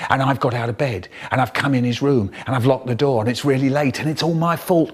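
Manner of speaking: usual speaking voice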